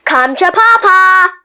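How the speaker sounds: loud, high eager cry; greedy call; hungry tooth shouts; shouted. Acted. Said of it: "shouted the tooth".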